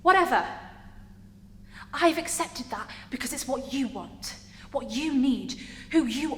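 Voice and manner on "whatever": louder, higher burst of exasperation; exasperated dismissal. Acted. Character giving in to partner's demands, exasperated. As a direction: toss it off sharply and loudly.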